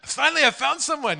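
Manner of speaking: loud, high, excited discovery; high-pitched mock delight; eager excitement. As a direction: imitate someone thrilled to find company. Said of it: "he's, like, trying to engage".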